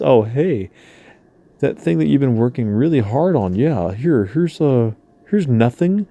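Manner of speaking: singsong, sarcastic fake-friendly pitch; put-on chirpy lowball offer; mock offer. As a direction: swoop the pitch up and down as a mocking ask.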